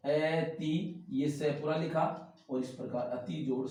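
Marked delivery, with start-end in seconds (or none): 0.1-1.1 very slow, syllable by syllable; spelling out slowly. Shown as a demonstration. Teacher spelling the word for students, very slowly. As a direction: draw out each syllable for dictation. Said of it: "देखिए इसमें ये स पूरा आएगा".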